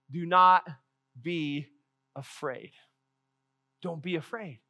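tender, fading "Do not be afraid"; calm reassurance. Acted. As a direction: hush them like a calm shepherd. Moses' true calm words, contrasted.

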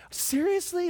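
high-pitched disbelieving question; acted incredulity, pitch jumping up; incredulous. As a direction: squeak it out in mock disbelief. Retold disbelief at dad's order.

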